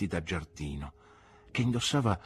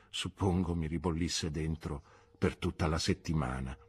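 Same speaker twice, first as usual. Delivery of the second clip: usual speaking voice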